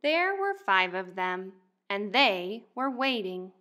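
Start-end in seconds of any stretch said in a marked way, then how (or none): none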